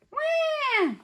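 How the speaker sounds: put-on high, loud baby wail; baby's yell. A narrator's reading. Baby ghost amid the quarrel, high yelling wail. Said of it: "yelled Baby Ghost".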